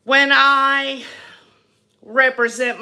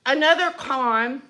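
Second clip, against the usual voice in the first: ordinary delivery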